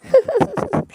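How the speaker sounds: high little giggle; a bright baby-like giggle. Acted. Baby narrator laughs at brother. (ऊँची खिलखिलाहट)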